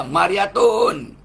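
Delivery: "Mariatun" drawn out slow, high and loud; playful Javanese-style "Mariatun", slow and high; stretched joke name. Acted. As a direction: stretch "Mariatun" out, landing on the -tun.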